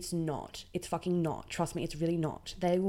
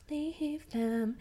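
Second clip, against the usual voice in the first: high emphatic; 9 semitones higher than usual. Real, not acted. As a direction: Leave them pitched very high. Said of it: "repeated advice".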